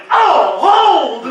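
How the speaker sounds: loud hammed-up holler; big shout, laugh close behind; retold shout. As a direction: call a halt over a noisy battle. Retold call halting the battle.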